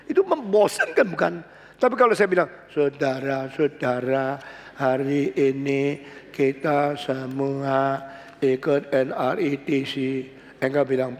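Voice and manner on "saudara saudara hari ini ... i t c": slow, a dull drone; boring monotone. Shown as a demonstration. Preacher shows a dull monotone greeting to the congregation. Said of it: "nadanya terus sama".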